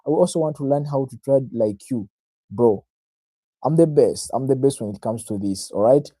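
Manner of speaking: ordinary talk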